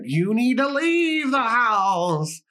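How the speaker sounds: drawn-out, high-pitched cartoon villain; Scooby-Doo villain. Podcaster mocks scare tactics, as a drawn-out cartoon villain. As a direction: stretch it out like a hammy cartoon baddie. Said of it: "this is such Scooby-Doo villainy".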